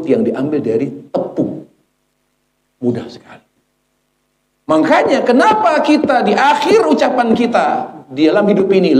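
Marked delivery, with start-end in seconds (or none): 4.7-9.0 high, forceful question; impassioned preaching. Real, not acted. Preacher asking why last words matter, impassioned. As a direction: raise it high and urgent. Preacher exhorts congregation at height.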